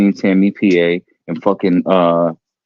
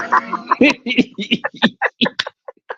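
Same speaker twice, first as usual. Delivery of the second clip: breathy laugh; 13 semitones higher than usual. Real, not acted. Breathy laugh after gasp.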